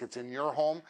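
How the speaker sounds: normal talking voice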